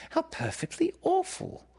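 put-on very high girl's lament; dismayed Wendy. A narrator's reading. Wendy hearing the boys know no stories, high dismay. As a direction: sympathise in a high, dismayed little voice.

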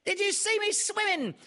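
very high, loud thrilled joey voice; excited squeak. A narrator's reading. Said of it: "squeaked Roo excitedly".

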